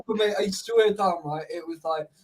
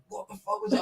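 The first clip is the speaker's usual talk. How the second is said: shocked; 10 semitones higher, 6 dB quieter than usual. Retold or imitated. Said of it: "And then I just went".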